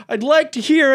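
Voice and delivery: very high, loud mock holler; exaggerated high impression of a yell; imitated yell. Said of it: "When you yelled".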